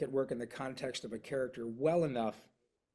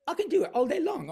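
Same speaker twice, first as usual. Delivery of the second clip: much higher, louder, fast British Cockney voice; Pinky's Cockney; 13 semitones higher, 6 dB louder, faster than usual. Shown as a demonstration. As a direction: rattle it off in a Cockney cartoon voice. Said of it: "kind of a Cockney thing with Pinky".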